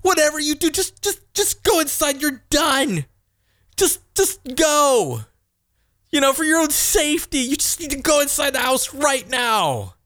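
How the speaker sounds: a dad at the end of his rope sending his son away; retold frustrated outburst. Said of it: "And I said to him".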